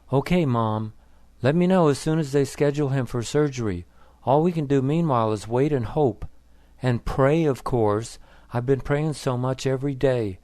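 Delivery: ordinary delivery, nothing marked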